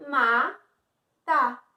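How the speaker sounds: "Ma-ta" in two loud, separated beats; teacher counting the two syllables of "Ma-ta". Shown as a demonstration. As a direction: sound out "Ma-ta" slowly, pausing between syllables. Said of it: "Duas sílabas".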